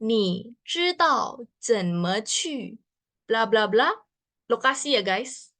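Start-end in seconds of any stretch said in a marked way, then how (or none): 0.0-3.9 slow demo. Shown as a demonstration. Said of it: "kalian bisa lihat di sini".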